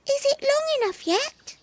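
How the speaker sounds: squeaky pleading kitten, high pitched. Acted. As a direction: beg like a little kitten. Kitten asks.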